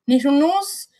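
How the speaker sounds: rising, puzzled New Zealand pronunciation; New Zealand accent. Retold or imitated. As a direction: query nettle nose in a New Zealand accent. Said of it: "Does that sound like I'm saying needle nose".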